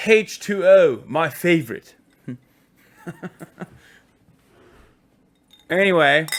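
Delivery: put-on posh English accent; mock-posh English delivery. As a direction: play the posh English gent. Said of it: "I can't do the accent anymore".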